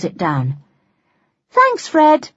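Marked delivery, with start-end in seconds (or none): 1.5-2.3 airy. Acted. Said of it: "she said airily".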